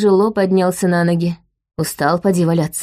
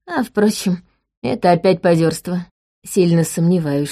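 ordinary delivery, nothing marked

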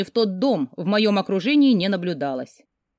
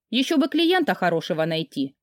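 ordinary delivery, nothing marked

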